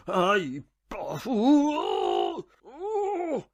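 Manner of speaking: loud yelp, then long high groan; drawn-out howl of pain, dying away; gemidos de dor. Acted. Pain attack strikes the patient.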